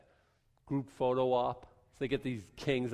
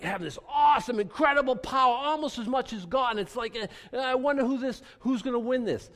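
higher, louder mock awe at Satan's power; 9 semitones higher, 3 dB louder than usual. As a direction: ham up the epic struggle, pitched higher. Mocking overblown view of Satan.

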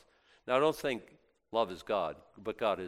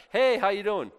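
bright, louder and higher hey how you doing; casual greeting; 9 semitones higher, 11 dB louder than usual. Retold or imitated. Preacher mimicking an apostle's friendly hello, louder and higher. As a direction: toss it off like a cheery hello to a friend. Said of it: "this is just like a".

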